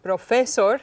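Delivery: "Profesor" wrongly stressed as pro-FE-sor; stress on middle syllable. Shown as a demonstration. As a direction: lean on FE in "Profesor". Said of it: "putting stress on all three syllables".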